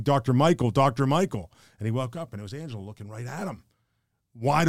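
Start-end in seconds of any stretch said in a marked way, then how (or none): 0.0-1.5 loud urgent call, short of a real scream; retold scream. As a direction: call out the name as if waking someone. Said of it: "woke up to someone screaming".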